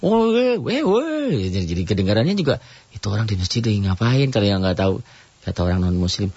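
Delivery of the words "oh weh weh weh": high jeering shouts; hammed-up crowd yell; mock rowdy yelling. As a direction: hoot like a noisy crowd. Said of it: "Pelan aja".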